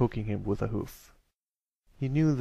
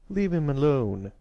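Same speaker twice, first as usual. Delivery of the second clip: put-on raised older-brother command; angry protective shout; 4 semitones higher, 5 dB louder than usual. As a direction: order the bullies off, voice up. Angry older brother rushing over.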